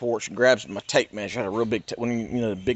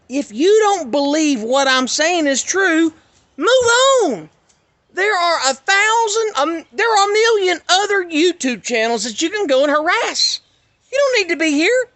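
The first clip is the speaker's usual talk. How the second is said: real angry outburst; 7 dB louder than usual. Real, not acted. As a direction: lash out at the harassers. Tells internet trolls to leave.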